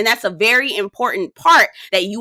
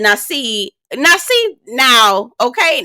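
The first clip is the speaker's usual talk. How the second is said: rising sass on now see; wag-your-finger "now see"; mock scolding, climbing high; 4 semitones higher, slower than usual. Scolding Chaz in a retold quote.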